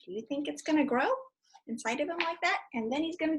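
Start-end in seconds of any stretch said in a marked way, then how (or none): none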